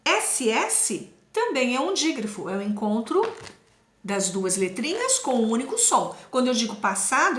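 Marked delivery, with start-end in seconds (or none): none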